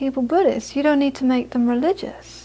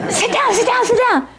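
high, rapid-fire mock alarm; acted high-pitched panic; frantic urging; 11 semitones higher than usual. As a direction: urgently get him back in his seat. Students frantically hushing cheering Geshe.

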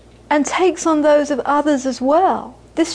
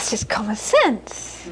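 slow, soft, matter-of-fact shrug; dismissive mother; 5 dB quieter, slower than usual. Retold or imitated. The Scottish mother dismissing Buddhism, slow and matter-of-fact. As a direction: shrug it off like it's obvious. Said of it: "She'd say".

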